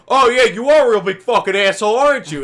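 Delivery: imitating an angry man, high loud shout; hammed-up, shouted sarcastic challenge; aggressive taunt. Lawn guy squares up to the speaker, aggressive taunt.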